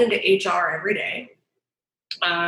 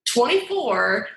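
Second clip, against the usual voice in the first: ordinary talk, nothing marked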